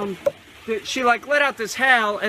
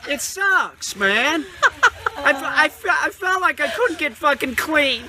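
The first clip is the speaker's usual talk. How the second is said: high, strained, tearful whine; hammed-up crying; mock sobbing breakdown; 8 semitones higher than usual. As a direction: blubber it out, half laughing. Mock breakdown, Let it out baby.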